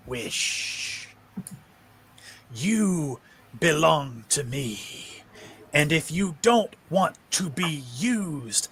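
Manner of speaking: slow, scornful monster voice, swelling at the end; the master reminding his servant who owns him; contemptuous creature. Acted. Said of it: "you hear the thing say".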